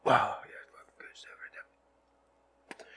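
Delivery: whispered, slow words holding in a lungful of smoke; strained smoky whisper after a toke. Real, not acted. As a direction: talk without letting the smoke out. Just took a hit of joint.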